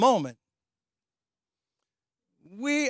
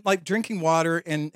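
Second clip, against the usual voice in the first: ordinary talk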